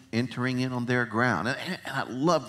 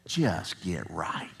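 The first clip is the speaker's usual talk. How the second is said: whispered aside; 6 semitones lower, 5 dB quieter, slower than usual. Pretend. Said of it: "Turn to somebody and say".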